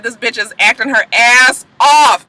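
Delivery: loud, high rave; gushing praise. Real, not acted. Praising the actress to her kids, loud and gushing. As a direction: rave about her, stretching it out. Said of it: "I said".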